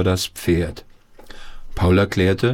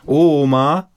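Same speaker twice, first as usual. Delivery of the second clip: calling out; 8 semitones higher, 5 dB louder than usual. A narrator's reading. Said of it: "rief Paul".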